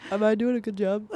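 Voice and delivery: put-on lightly nasal little kid asking for praise; a hint of an eager kid wanting approval; nasal seven-year-old voice. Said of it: "Who's very nasally".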